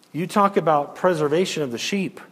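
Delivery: ordinary talk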